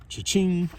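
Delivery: high, bright, punchy cash-register cheer; excited cha-ching. Acted. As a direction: sing it out like a jackpot win. Celebrating the lemonade money.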